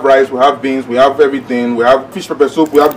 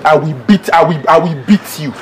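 ordinary talk

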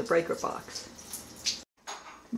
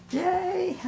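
excited; 13 semitones higher than usual. Real, not acted. Cheering on seeing the office.

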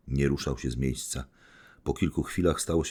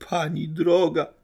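much higher, loud, slow plaintive wail; complaining; 14 semitones higher, 7 dB louder, slower than usual. Acted. Liebedziew lamenting to the lady over the money, high whine. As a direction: whine it out pleading. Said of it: "narzekał dalej Liebedziew".

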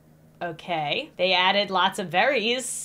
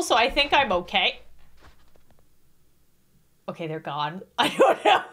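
usual speaking voice

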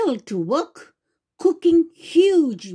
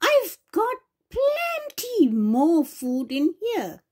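witch voice; 6 semitones higher than usual. Acted. Witch speaking in story.

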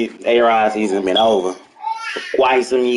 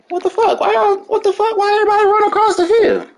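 high, fast, disbelieving outcry; shocked yelling; faster than usual. Real, not acted. Gamer yelling at the game in shock. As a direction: yell it in total disbelief at the screen.

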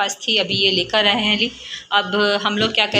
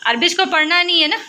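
high coaxing mom voice; 8 semitones higher, 3 dB louder than usual. Real, not acted. Mother coaxing her angry daughter to draw, high and sing-song.

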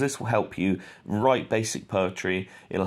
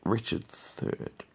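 whispered to himself; 5 semitones higher, 6 dB quieter than usual. Real, not acted. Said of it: "muttering while searching".

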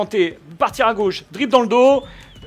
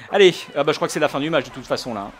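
ordinary talk

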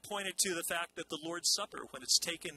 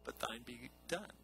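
much softer, yielding whisper of a prayer; surrendered prayer; 4 semitones lower, 8 dB quieter than usual. Retold or imitated. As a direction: let go, quietly giving in. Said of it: "he finally comes to the place where he says".

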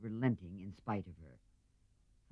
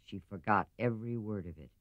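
ordinary delivery

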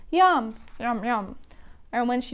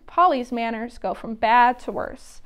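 usual speaking voice

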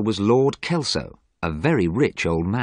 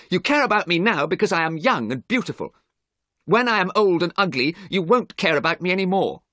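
high, heated protest, moderately raised; acted shout; 11 semitones higher than usual. A narrator's reading. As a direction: protest hotly with pitch pushed up. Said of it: "he shouted".

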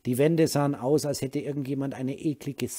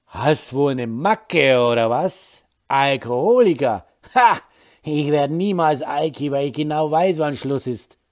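put-on angry drunk; 3 semitones higher, 10 dB louder than usual. A narrator's reading. Drunk Holger angrily mocks his wife's accusation. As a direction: sneer at her, loud and drunk. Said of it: "sah sie böse an".

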